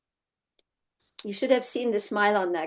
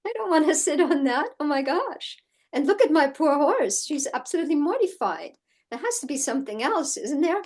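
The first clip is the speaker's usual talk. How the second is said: higher, fast worried pleading, half-playful; retold anxious protest; 6 semitones higher than usual. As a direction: rush through it like a rider alarmed for her horse. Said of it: "And I'm like".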